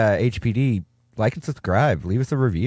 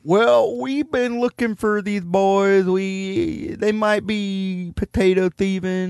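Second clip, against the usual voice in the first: higher, slow hammed-up police officer; mock cop; 7 semitones higher, slower than usual. Cop at the door describing the suspects, high slow hammed-up voice. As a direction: drawl it out like a dim cop.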